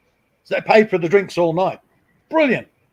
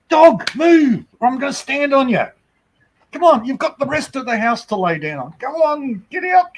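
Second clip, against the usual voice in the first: shooing the dog; 10 semitones higher than usual. Real, not acted. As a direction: shoo the dog off the spot.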